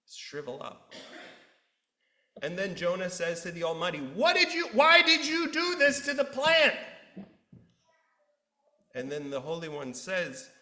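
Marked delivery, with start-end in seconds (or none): 4.0-6.8 high, loud, indignant demand; outraged protest. Retold or imitated. Jonah protests to the Almighty, high and loud. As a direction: cry out at God in disbelief.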